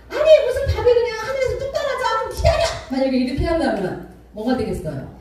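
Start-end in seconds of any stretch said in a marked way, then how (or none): 0.1-2.6 high-pitched exasperated wife retort; mock annoyed, voice pitched way up; acted irritation back. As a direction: play a wife snapping back at her husband. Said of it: "막 짜증을 낼 때 아내가 같이".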